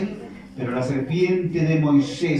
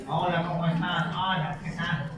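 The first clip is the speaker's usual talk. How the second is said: soft, nose-clipped greeting; pinched nose voice; 6 dB quieter than usual. Acted. Egyptians greeting with noses pinched, soft and nasal. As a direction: talk with a clip on your nose.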